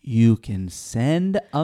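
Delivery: slow, word by word; over enunciating. Shown as a demonstration. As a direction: spell it out slowly like to a child. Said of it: "Let me say it really slowly".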